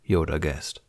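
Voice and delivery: ordinary delivery, nothing marked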